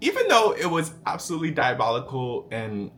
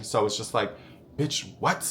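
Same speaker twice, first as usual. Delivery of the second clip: usual speaking voice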